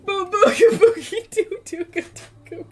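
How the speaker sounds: sing-song nonsense through giggles. Real, not acted. Streamer inventing the mask's last name, giggling high baby talk. Laughing fit over mask name.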